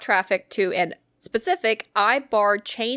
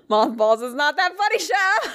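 high laughing protest; 10 semitones higher than usual. Real, not acted. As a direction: giggle the words out. Still laughing.

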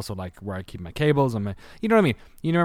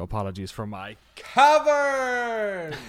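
mock grand apology; 12 semitones higher, slower than usual. Joking about his echoey room.

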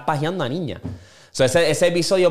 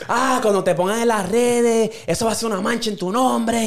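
guilt-tripping Don; 8 semitones higher than usual. Retold or imitated. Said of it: "empezó a decir".

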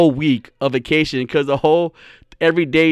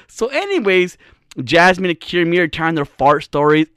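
ordinary talk

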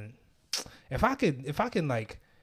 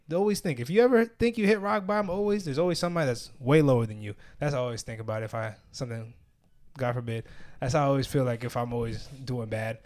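usual speaking voice

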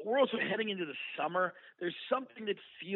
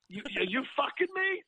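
pitched higher, a playful mock scream; 9 semitones higher than usual. To the hospital staff about pay, a higher mock scream. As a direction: screech the question in fake outrage. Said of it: "I start screaming".

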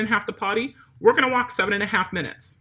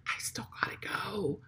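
soft sneaky whisper; whispered dog voice; 13 dB quieter than usual. Pretend. Trainer voicing the dog sneakily admitting to its owner, whispered. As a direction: whisper it like the dog's guilty secret.

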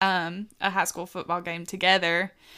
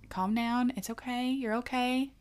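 softer, gentle calming reassurance; soothing; 5 semitones higher, 6 dB quieter than usual. Retold or imitated. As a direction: imitate the boyfriend talking her down softly. Boyfriend soothing her on phone.

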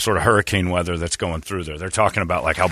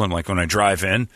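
normal talking voice

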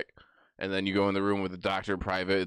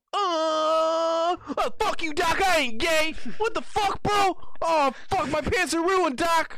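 very high, loud, crying mock wail; wailing patient; 5 dB louder, slower than usual. Patient getting examined, wailing high and loud at the doctor. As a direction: howl at the doctor in hammed-up distress. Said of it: "And I go".

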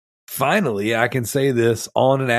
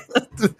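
wheezing giggle; breathless high laugh; high wheezy laugh; 11 semitones higher than usual. Real, not acted. Laughing at being sick.

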